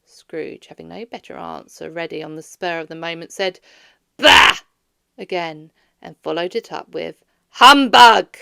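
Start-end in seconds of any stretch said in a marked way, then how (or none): 4.2-4.6 put-on loud, scornful old-man snort; Scrooge scoffing. A narrator's reading. 7.5-8.4 put-on loud, scornful old-man snort; Scrooge scoffing. A narrator's reading.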